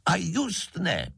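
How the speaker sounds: high, drawn-out stubborn cry; shouted refusal. A narrator's reading. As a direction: cry out in defiance, pitch way up. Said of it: "křikl šejtroček".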